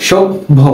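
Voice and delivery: সভ্য broken into "shob... bho"; split doubled consonant. Shown as a demonstration. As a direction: say সভ্য in two beats, bh closing and reopening. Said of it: "এরপর আবার বললাম ভ".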